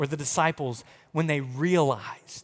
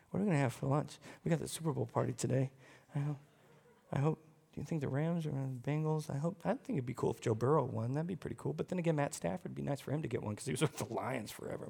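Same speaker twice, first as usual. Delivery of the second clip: distracted mumble; 9 dB quieter, faster than usual. Mimicking a distracted churchgoer's thoughts.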